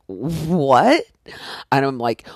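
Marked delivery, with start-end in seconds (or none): 0.1-1.0 surprised. Retold or imitated. [name] surprised.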